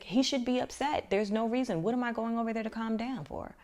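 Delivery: high, loud imitated outrage; indignant protest. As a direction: voice an outraged friend refusing to step in. Friend's outraged protest, retold.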